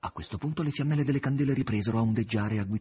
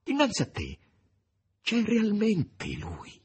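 high, slow, frightened woman's voice; frightened plea; 10 semitones higher, slower than usual. Acted. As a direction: plead with him, scared, to see it's real. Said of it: "disse Margherita spaventata".

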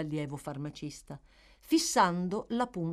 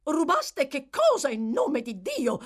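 much higher, louder, slow alarmed cry; shocked shout; 10 semitones higher, 7 dB louder than usual. Acted. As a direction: demand it in horror. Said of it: "gridò la direttrice".

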